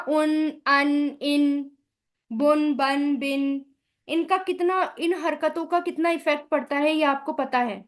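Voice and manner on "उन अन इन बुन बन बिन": उन, अन, इन, बुन, बन, बिन said slowly one by one, each vowel marked; careful drill of the syllables बुन बन बिन; vowel sounds demo. Shown as a demonstration. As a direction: sound out each short vowel, उन अन इन, clearly apart. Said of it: "जैसे हम बोलते हैं ना".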